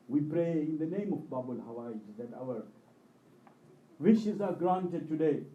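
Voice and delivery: normal talking voice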